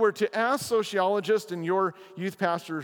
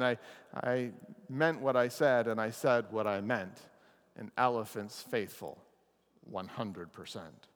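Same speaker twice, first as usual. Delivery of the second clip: lower, soft, earnest elephant voice; faithful elephant refrain; 8 semitones lower, 5 dB quieter than usual. Acted. Horton repeats his promise of faithfulness, low and soft. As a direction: say it like a quiet, solemn vow.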